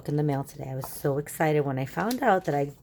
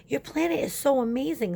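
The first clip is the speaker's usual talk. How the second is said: higher, wonder-filled praise; amazed; 10 semitones higher than usual. Acted. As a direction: gush about the planet. Stanley amazed by planet.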